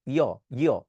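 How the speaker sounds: "ইয়" as a gliding y sound. Shown as a demonstration. Teacher showing students the y sound. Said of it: "সেটা হয় ইয়".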